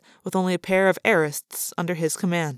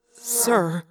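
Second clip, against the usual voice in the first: small, higher-pitched, tentative address; timid; 4 semitones higher than usual. A narrator's reading. Arbron interrupts the brooding prince, timidly. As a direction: venture it timidly. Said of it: "Arbron asked timidly".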